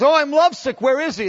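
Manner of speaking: high, loud lovesick exclamation; swooning lovesick outburst; lovesick cry. Retold or imitated. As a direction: cry out with longing. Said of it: "She goes".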